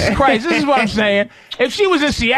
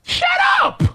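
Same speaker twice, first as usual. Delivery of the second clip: disbelieving shout. Real, not acted. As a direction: yell it in total disbelief. Disbelief at the movie news.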